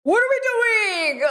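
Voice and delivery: high, breaking into helpless laughter; laughing in disbelief. Real, not acted. Reacting to the wasabi lyric, laughing in disbelief. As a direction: laugh it out, half crying.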